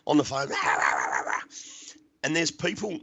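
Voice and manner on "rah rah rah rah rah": rough, wordless mock rant; mimicked screaming tirade; imitated screaming. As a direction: rant down the phone in gibberish. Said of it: "I'm screaming at her".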